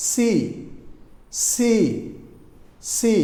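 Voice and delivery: "সি" with a clear s into ee; each "সি" set apart by a pause; isolated C sound. Shown as a demonstration. Teacher models the letter C, deliberate and separated. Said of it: "sounds like".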